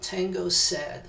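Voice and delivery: Spanish said with an American English accent; gringo accent. Shown as a demonstration. Teacher demonstrates to Spanish-speaking students in a gringo accent. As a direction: say tengo sed like a gringo, American r and vowels. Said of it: "piensen como un gringo dice".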